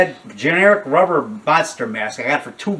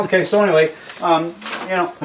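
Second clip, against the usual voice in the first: ordinary talk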